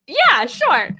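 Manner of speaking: feigned agreement. Retold or imitated. Playing along with husband's claim.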